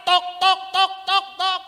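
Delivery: loud, high, pounding hammer sounds; shouted knocking noises; loud hammering tok tok. Retold or imitated. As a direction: bang it out like hammering wood. Preacher mimics Noah hammering loudly.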